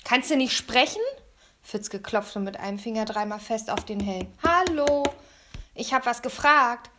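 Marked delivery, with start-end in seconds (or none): 0.1-1.0 loud, raised, impatient question; irritated demand. A narrator's reading. Neighbour demands an answer. 4.4-6.9 loud, high, annoyed hail; irritated, insistent shout; impatient calling. A narrator's reading. Neighbour knocks, demands an answer.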